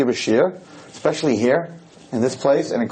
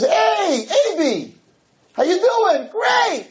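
cheery beach greeting; much slower than usual. Retold or imitated.

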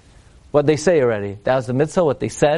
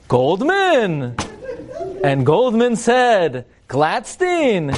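drawn-out, portentous calling of surnames; mock-grand address; 5 semitones higher, much slower than usual. Rabbi imagining two audience members hailing each other, mock-grand. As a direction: hail each name like stilted drama. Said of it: "Imagine I went over to you".

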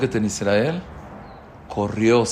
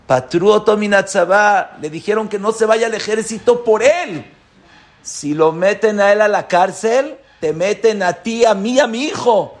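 anxious plea; 9 semitones higher, 7 dB louder than usual. Retold or imitated. Wife pleading about their son.

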